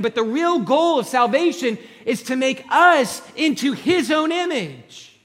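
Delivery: loud, high, driving sermon delivery; full-force preaching. Acted. Pastor urging the congregation, full-force preaching.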